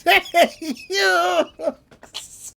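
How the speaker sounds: high hammed-up yell of Yokes; Joey Wheeler call. Joey Wheeler greets his friend from the throat, high yell. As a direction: call it out like a cartoon sidekick. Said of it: "He says".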